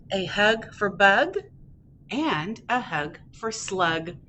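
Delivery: happy duckling, picture-book reading. Acted. Ducky off to play in the rain, happy read-aloud. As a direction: read playfully to toddlers, bouncing the quacks.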